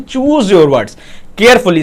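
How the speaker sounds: slow English motto; slow emphatic heading. Shown as a demonstration. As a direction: choose your words carefully, drawn out very slowly. Repeating key heading slowly.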